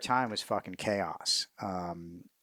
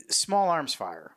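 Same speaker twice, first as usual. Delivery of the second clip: normal talking voice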